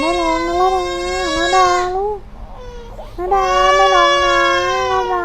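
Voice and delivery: put-on nanny begging the baby to stop crying; anxious soothing. (ปลอบเด็กเสียงดังร้อนรน)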